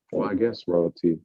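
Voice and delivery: ordinary talk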